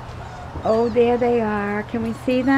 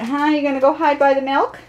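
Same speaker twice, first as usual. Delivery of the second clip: usual speaking voice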